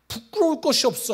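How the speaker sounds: high, loud cry of conviction; preaching shout. Acted. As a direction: proclaim it up high. Preacher's emphatic climax.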